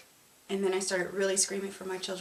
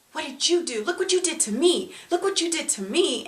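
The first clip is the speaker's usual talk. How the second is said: loud, higher accusing shout; husband yelling blame at his wife; retold angry yell; 8 semitones higher, 7 dB louder than usual. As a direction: throw the blame back at her, yelling.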